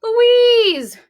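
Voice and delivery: high, loud relieved cry; high, loud call of joy and relief. Acted. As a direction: cry out her name in relief. Sheila finds her sister.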